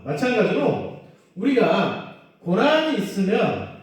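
ordinary delivery

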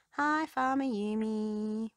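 loud, slow called greeting; calling out. A narrator's reading. Rubble arriving at the farm, calling to Farmer Yumi. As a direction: call a hello across the farm. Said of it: "Rubble called".

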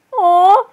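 high-pitched cooing aww; adoring aww. Real, not acted. Streamer reacting to a cute plush, adoring aww. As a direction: melt into a high "aw".

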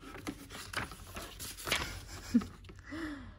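soft laugh. Real, not acted. Finding old passports while sorting.

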